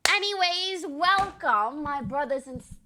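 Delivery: loud, hammed-up British accent greeting. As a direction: greet the crowd like a posh Brit. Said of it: "why is that a British accent?".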